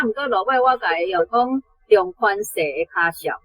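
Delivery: ordinary delivery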